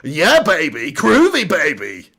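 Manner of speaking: swooping, groovy spy catchphrase voice; Austin Powers. Acted. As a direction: swing the pitch up and down playfully. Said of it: "Do Austin Powers".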